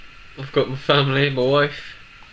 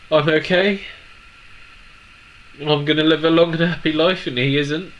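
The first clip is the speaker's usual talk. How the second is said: tearful and resolute; 4 semitones higher, 6 dB louder than usual. Real, not acted. Tearful vow after crying.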